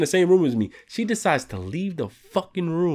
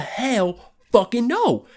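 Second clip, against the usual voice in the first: high, drawn-out incredulous refusal; slow, pitched-up mock outrage; emphatic refusal; 9 semitones higher, slower than usual. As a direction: stretch it out high, utterly refusing. Acts out refusing to stay.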